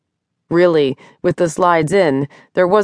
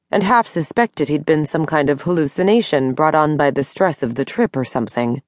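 plain reading voice

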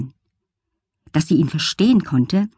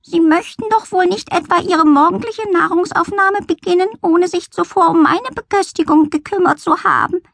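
much higher, prim grumpy cat; snooty cat voice; 13 semitones higher than usual. Acted. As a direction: meow it out like an offended lady cat. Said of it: "Mit miesepetrigem Gesicht blickte sie Jesaja an und maunzte".

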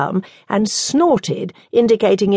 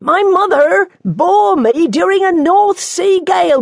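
loud, high shout into wind; 12 semitones higher, 5 dB louder than usual. Acted. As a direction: shout it over the gale. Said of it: "the nun shouted".

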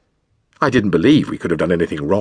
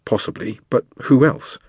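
usual speaking voice

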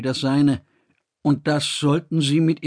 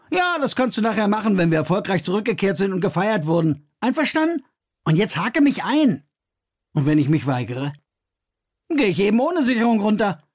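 higher, quick and dismissive; Kors, breezy; 6 semitones higher than usual. A narrator's reading. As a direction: Kors waves off the council threat, breezy and cocky. Kors brushes off threat.

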